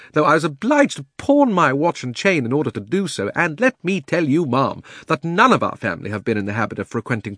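high, loud fussy outrage; indignant old gentleman. Acted. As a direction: bluster like an offended old gent. Uncle Andrew's indignant tirade.

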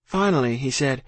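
usual speaking voice

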